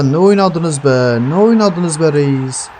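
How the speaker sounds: slow, stretched "oynadınız", each syllable dragged out; drawn-out mock disbelief. Real, not acted. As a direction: drag the line out in sarcastic disbelief. Mocking team's wasted possession.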